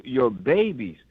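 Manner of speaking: normal talking voice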